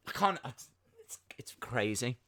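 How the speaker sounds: soft unvoiced whisper; whispered disbelief. Real, not acted. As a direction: breathe it in hushed disbelief. Aside in disbelief.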